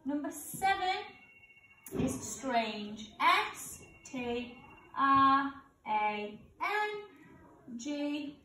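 usual speaking voice

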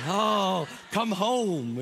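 hammed-up desperate moan; comic pleading. Worn-out grandparents begging the parents back, mock pleading. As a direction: beg with a mock-weary wail.

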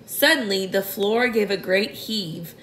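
ordinary delivery, nothing marked

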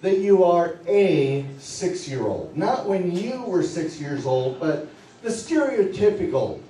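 low, soft, unhurried hypnotist voice; hypnotic induction. Acted. Stage hypnotist guiding volunteers into the role, low and soft. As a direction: lull them along gently and steadily.

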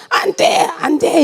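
high, strained refusal without full volume; retold shout of resistance; forceful mock cry. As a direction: imitate a fierce cry, held back. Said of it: "이렇게 강하게 외쳤어요".

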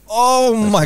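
high, drawn-out mock wail; mock bawling. Retelling his tears at a sad film scene, mock bawling. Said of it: "I bawled my eyes out".

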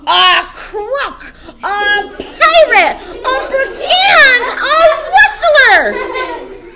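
very high, slow, escalating screams; screamed accusations. A narrator's reading. Headmistress accuses the boy before all, screaming. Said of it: "the Trunchbull screamed".

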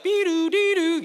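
high, loud two-note alert chime; imitated phone warning beep. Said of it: "That's like".

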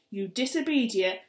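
loud, high scolding; angry mum screech. Acted. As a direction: tear into the kids as a frantic mother.

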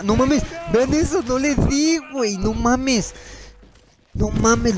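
high, incredulous cry of frustration; can't believe he missed; yelping no mames at the screen; outraged disbelief. Real, not acted. Missed an easy kill, outraged.

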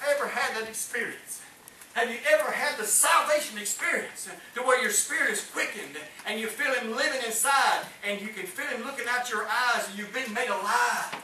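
usual speaking voice

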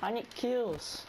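slow, high, stretched whine; drawn-out whine. Real, not acted. Desperate for kills.

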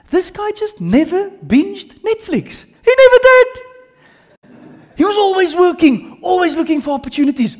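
high, loud, incredulous praise; amazed. Real, not acted. Teacher marveling to class at Paul's tireless work, high and loud. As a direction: marvel that he never rested.

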